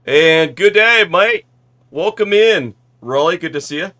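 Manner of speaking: Aussie greeting. Pretend. Greeting viewer in Aussie style.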